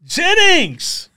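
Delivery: high, loud burst of the name; excited exclamation. Real, not acted. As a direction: call out the name with delight.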